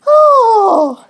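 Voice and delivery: loud high horrified scream; Red and the lumberjack shriek loud and high at the blood. Acted. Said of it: "they screamed".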